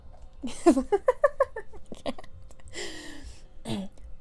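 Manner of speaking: long, high giggle; helpless giggles, unable to read on; real laughing fit. Real, not acted. Reader losing it after a character's line, laughing.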